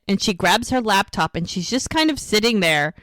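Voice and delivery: usual speaking voice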